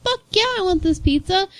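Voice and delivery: high-pitched excited acceptance; retold eager yes. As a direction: imitate a thrilled coworker. Retells coworker eagerly accepting pizza.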